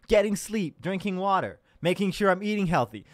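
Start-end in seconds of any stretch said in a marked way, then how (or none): none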